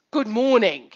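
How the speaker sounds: "Good morning" hit hard on "Good"; forceful greeting. Shown as a demonstration. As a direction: push out "Good morning" emphatically. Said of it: "Or I can say it like this".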